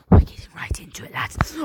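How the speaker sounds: fast mock whisper. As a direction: lean in and whisper to the lads. Sudden whisper in opening.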